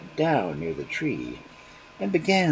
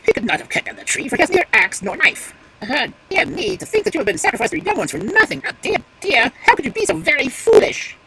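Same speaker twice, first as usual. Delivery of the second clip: much higher and louder, exasperated; scolding crow; 14 semitones higher, 10 dB louder than usual. Acted. Crow scolding the magpie for her foolishness, exasperated. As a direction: lecture her for being fooled.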